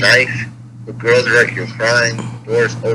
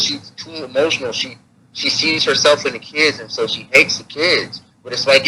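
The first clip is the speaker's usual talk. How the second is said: usual speaking voice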